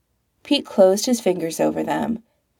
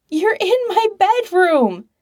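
much higher, strained panicky pitch; too high-pitched; 13 semitones higher, 3 dB louder than usual. A narrator's reading. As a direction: voice jumping up in alarm. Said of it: "His voice was a little too high-pitched".

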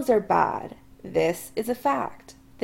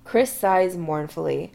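ordinary delivery, nothing marked

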